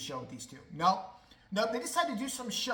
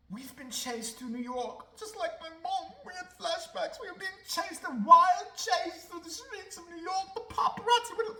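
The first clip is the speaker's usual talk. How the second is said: high, dramatic mock retelling; mocking the couple; 10 semitones higher, 4 dB louder than usual. Mocking the couple's paparazzi chase tale, high and theatrical. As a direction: ham up their chase story, pitched way up. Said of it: "So they decide".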